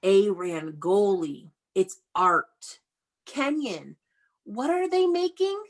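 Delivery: usual speaking voice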